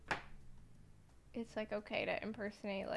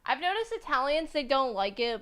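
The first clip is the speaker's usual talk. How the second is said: emphatic loud; 4 semitones higher, 11 dB louder than usual. Real, not acted.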